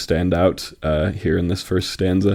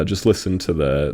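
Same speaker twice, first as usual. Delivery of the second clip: usual speaking voice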